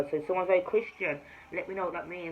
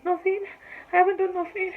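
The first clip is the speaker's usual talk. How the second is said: frightened denial; 11 semitones higher, 6 dB louder than usual. Retold or imitated. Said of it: "And I was there like".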